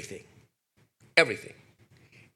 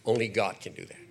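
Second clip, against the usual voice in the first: ordinary delivery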